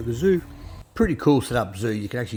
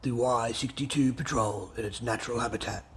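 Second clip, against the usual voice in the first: nature documentary; 3 semitones lower, 4 dB quieter than usual. Pretend. Mock nature documentary narration.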